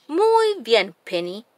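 ordinary delivery, nothing marked

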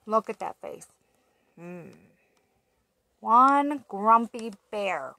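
ordinary delivery

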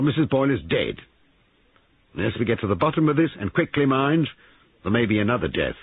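insistent and grave; stern police sergeant. A narrator's reading. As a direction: warn the room firmly, as an investigator.

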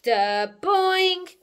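loud boing leaping high; imitated bouncing ball. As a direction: boom out a cartoon bounce. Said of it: "And he bounced the ball".

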